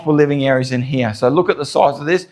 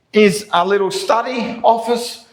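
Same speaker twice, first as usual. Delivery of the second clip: big hall echo; 5 semitones higher than usual. Real, not acted. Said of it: "Sorry about the echoing 'cause it's empty".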